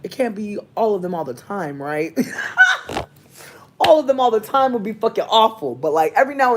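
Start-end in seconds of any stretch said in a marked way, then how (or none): 2.2-3.1 shrieking burst of laughter; cracking up at own joke; high squealing laugh. Real, not acted.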